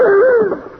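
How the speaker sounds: very high, loud shocked gasp; horrified gasp. Acted. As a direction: gasp at what dripped into your hand. Drip lands in his hand.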